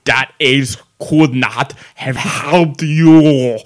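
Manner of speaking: slow, loud, hammed-up bad Russian accent; terrible Russian accent. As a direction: quote it in a gibberish mock-Russian accent, dragging the words out. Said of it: "just do a terrible gibberish accent".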